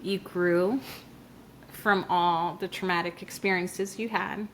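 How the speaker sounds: low, soft reassuring voice; gentle self-advice. Acted. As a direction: comfort your younger self. Speaking to younger self.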